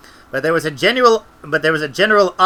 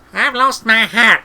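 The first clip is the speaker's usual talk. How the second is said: slow, high, loud character voice; Granny's complaint; 7 semitones higher, 5 dB louder, slower than usual. A narrator's reading. Granny fishing in the water, slow high complaint. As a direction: wail it slowly in Granny's voice.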